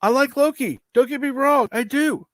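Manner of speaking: real protest, pitched high and loud. Real, not acted. Softening a rant about a host's voice, high loud protest. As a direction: insist loudly in a high voice. Protesting after harsh criticism of voice.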